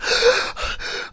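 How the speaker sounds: high, breathy gasp of horror; the heroine spying from the stairs, gasping; imitated shocked gasp. Sudden shocked gasp. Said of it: "her face go like".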